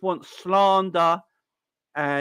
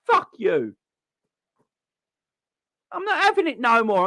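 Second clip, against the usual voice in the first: angry outburst; 9 semitones higher than usual. Real, not acted.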